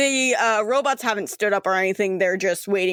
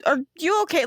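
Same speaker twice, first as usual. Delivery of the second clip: higher, puzzled soldier's question; taken aback; 6 semitones higher than usual. Acted. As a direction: check on someone acting oddly. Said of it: "they're taken back".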